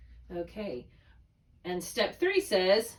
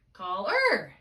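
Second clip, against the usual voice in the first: "caller" as call-ER, ER raised and loud; short call, big high ER; stress on second syllable; 6 dB louder than usual. Shown as a demonstration. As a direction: throw all the weight on the end of caller. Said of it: "It's higher".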